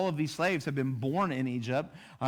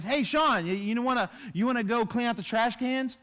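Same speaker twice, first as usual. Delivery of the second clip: higher, bright calling out to Sean; casual friendly ask; 6 semitones higher than usual. Retold or imitated. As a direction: toss a chore request to a friend. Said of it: "if somebody comes up to you and says".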